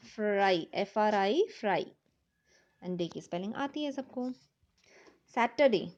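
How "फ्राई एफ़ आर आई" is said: ordinary talk, nothing marked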